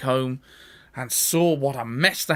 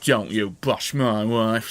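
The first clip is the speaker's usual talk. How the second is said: acting tough; 6 semitones lower, slower than usual. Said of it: "trying to act tough".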